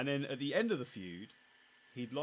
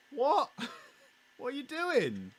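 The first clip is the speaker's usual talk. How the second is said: very high, loud squawk of disbelief; incredulous outburst; 9 dB louder, slower than usual. Acted. Fan yelling at the wrestling bookers, incredulous. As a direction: shout it as if baffled by their stupidity. Mock outrage at the booking.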